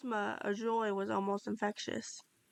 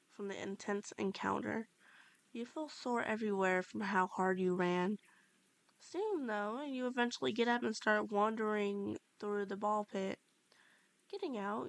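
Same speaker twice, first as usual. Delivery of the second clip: usual speaking voice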